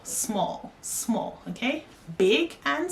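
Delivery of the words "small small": breathy little whisper for small; teacher whispering the word small to show its meaning. Shown as a demonstration. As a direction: whisper small, small to make it tiny.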